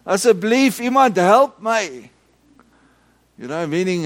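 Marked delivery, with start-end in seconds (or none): 0.1-2.1 high, loud plea for help in Afrikaans; shouting in Afrikaans. Retold or imitated. Blind man calling for help in Afrikaans, loud plea. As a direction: call out to the whole shop. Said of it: "continued to shout like that".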